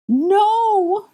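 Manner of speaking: very high, loud drawn-out cry; desperate high yell; screaming. Acted. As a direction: scream to stop a killing curse. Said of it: "Hermione screamed".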